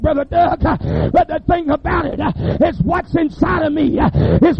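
preaching loud and climbing, building to shouts; full-force preaching. Acted. Preacher rousing the congregation with a full-force sermon.

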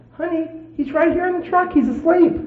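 very high put-on husband voice; high-pitched retold soothing; imitated reassurance. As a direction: explain it in a high, innocent pitch. Husband reassuring his hysterical wife.

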